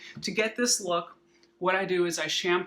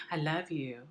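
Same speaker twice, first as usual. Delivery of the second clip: soft tender pet talk; talking to a dog; 5 dB quieter than usual. Real, not acted. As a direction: tell the dog softly.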